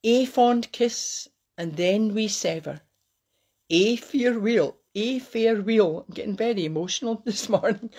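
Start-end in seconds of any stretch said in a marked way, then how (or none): 0.0-6.0 lifted line openings, falling ends; poem recital. Acted.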